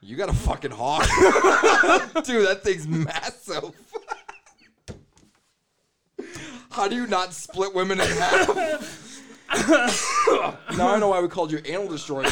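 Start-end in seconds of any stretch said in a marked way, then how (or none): 1.0-12.3 laughing. Real, not acted. Laughing while reacting to the reveal.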